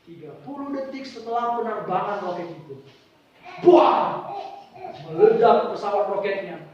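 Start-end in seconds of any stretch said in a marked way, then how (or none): 3.6-4.3 explosion. Retold or imitated. Rocket explodes in story.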